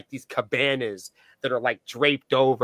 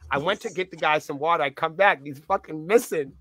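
usual speaking voice

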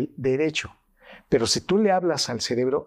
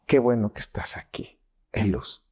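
lower, dull and fading, no warmth at all; cold, indifferent; 3 semitones lower than usual. Shown as a demonstration. Doctor showing prosody, coldly greeting Luz. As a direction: say the welcome like you couldn't care less. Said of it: "No es lo mismo que te diga".

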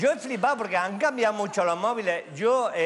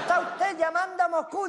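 put-on much higher young woman's voice with a foreign accent; 9 semitones higher than usual. Russian girl on the phone, in a higher young woman's foreign accent. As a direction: pitch way up to play the foreign girl. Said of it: "una voz de chica joven con acento extranjero".

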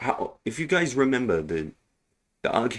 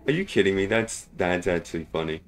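ordinary delivery

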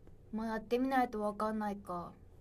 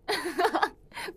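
rising giggle; 7 semitones higher, 6 dB louder than usual. Real, not acted. Laughing at comments.

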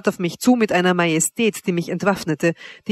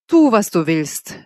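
slow, drawn-out shrug of a line; utter indifference; 4 dB louder, slower than usual. A narrator's reading. Wanda answers a suicide threat, utterly indifferent. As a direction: dismiss it, unconcerned. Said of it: "mit vollkommener Gleichgültigkeit".

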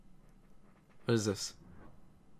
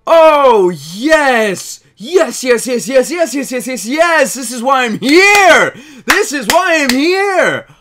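loud, high cheering, yes over and over; elated shouting; 6 semitones higher, 9 dB louder, slower than usual. Real, not acted. Streamer cheers at the trailer, shouting yes again and again. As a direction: cheer like you won it all. Celebrating the Pokemon announcement.

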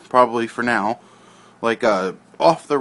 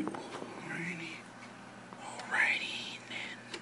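whisper; 4 semitones higher, 18 dB quieter, much slower than usual. Acted. Whispered aside to himself.

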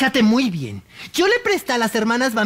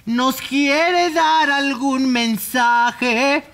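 normal talking voice

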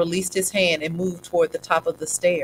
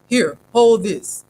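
slow handing over; 3 semitones higher, 6 dB louder, slower than usual. A narrator's reading. Old man handing over flashlight.

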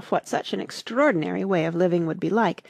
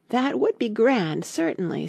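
thoughtful; 5 semitones higher than usual. A narrator's reading. Alice weighs the Hatter's idea, mildly thoughtful. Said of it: "said Alice thoughtfully".

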